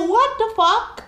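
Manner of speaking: a stunned outburst at his offer; stunned disbelief. Retold or imitated. He offers to buy clothes.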